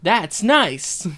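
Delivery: hint of a game announcer.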